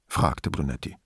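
ordinary delivery, nothing marked

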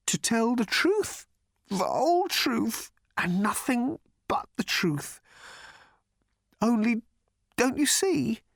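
high, slow, halting anguish; shivering distress. A narrator's reading. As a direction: shiver through it in broken, high phrases. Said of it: "he paused and shivered".

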